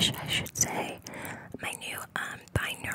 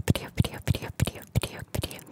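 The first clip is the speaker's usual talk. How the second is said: crisp repeated ASMR trigger; whispered trigger word; 5 dB louder, much faster than usual. Shown as a demonstration. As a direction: tap out each video. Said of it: "get started with the video".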